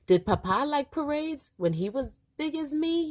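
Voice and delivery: put-on high, curious child voice. A narrator's reading. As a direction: ask like a little boy. Boy asking grandma.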